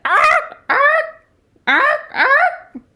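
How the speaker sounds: loud, high barking squawks; blue jay squawk. A narrator's reading. Blue Jay keeping Owl awake, loud high squawking. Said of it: "Blue Jay said".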